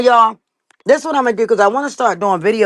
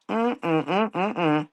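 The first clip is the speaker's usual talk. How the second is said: disapproving hum; 8 dB quieter than usual. Real, not acted. Disapproving hum after rant.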